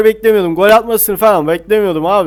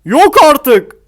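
stunned outcry; 7 semitones higher, 6 dB louder than usual. Real, not acted. Reacts to a save.

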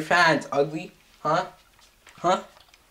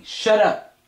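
sharp shouted shut up; 6 semitones higher, 4 dB louder than usual. Acted. Yelling at the other character.

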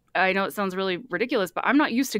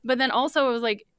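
normal talking voice

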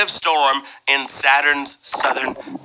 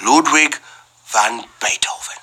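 whispering; 3 semitones lower than usual. Acted. Dramatic name reveal.

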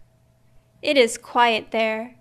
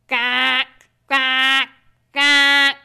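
slow quacks growing louder; big ducks quacking; 4 dB louder, much slower than usual. A narrator's reading. Reading to a child, the big ducks' louder quacks. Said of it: "The big ducks say".

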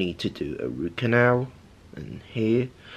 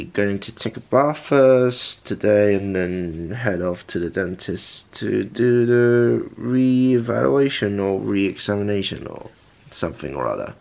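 ordinary delivery

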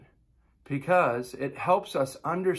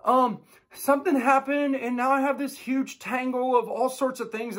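put-on much higher kid voice, hesitant; sheepish kid; 9 semitones higher than usual. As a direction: confess the mess like a guilty kid. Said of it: "the next kid's like".

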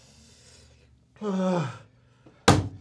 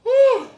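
pained woo; 9 dB louder than usual. Real, not acted. Burning mouth from hot pepper.

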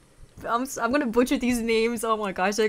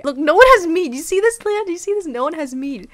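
excited protest; 6 semitones higher, 6 dB louder than usual. Real, not acted. Defending no meat on date.

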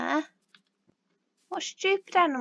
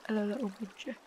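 tender to pet; 5 semitones lower, 6 dB quieter, faster than usual. Real, not acted. Talking to pet bird.

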